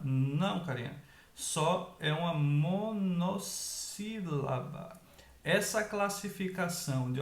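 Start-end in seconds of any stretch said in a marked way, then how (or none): 2.3-5.0 MONOSSÍLABA stretched out slowly; syllable by syllable. Shown as a demonstration. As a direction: draw out each syllable of monossílaba. Teacher spells out term by syllables. (monossílaba bem devagar, sílaba por sílaba)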